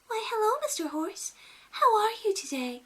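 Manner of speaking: very high, sing-song princess greeting. Retold or imitated. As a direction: coo at the horse like a Disney princess. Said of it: "goes up to the horse and says".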